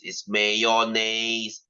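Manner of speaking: mayonnaise said slowly as may-o-nes; correct pronunciation. Shown as a demonstration. Teacher to class, slow corrective pronunciation. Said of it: "is pronounced as mayonnaise".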